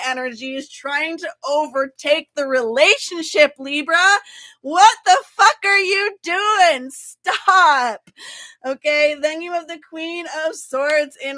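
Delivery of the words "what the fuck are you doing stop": sudden loud exasperated yell; mock outburst. Tarot reader yelling at Libra to stop. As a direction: snap at Libra like a fed-up friend. Scolding Libra mid-reading.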